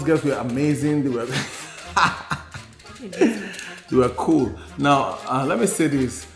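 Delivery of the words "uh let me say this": ordinary talk, nothing marked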